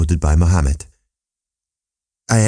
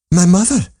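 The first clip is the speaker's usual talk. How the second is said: a sudden high cry of shock; cried out; 11 semitones higher than usual. Acted. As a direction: gasp out the words, much higher, stunned. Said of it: "cried I".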